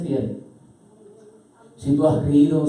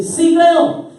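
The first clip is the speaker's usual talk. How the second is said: high, loud cry of belief; impassioned exclamation; exclaiming father; 13 semitones higher, 7 dB louder than usual. Acted. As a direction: shout it out with conviction. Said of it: "exclamó de inmediato el padre".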